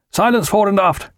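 high-pitched deck call; called order. A narrator's reading. Officer of the watch hushing the crew, calling out. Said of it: "called the officer of the watch".

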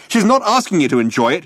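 shouted. A narrator's reading. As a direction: snap at the robot. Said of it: "he shouted".

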